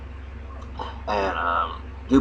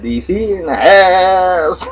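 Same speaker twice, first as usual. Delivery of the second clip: loud, slow, higher hype shout; hype call; 10 semitones higher, 8 dB louder, much slower than usual. Acted. He jokingly shouts out D C like a party host, slow and loud. Hyping up D C.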